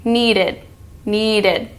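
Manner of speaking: "needed" ending in a light "id"; "needed" with the tongue tip up behind the teeth. Shown as a demonstration. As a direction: end "needed" with an open "id". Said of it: "same as the word wanted with the ending".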